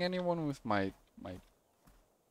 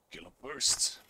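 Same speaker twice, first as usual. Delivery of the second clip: laconic gunslinger; slower than usual. Acted. Voices game hero answering.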